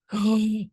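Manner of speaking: high surprised gasp; sharp gasp. Real, not acted. Sudden realization.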